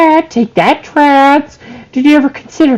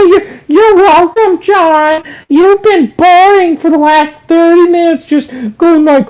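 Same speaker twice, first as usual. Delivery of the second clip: normal talking voice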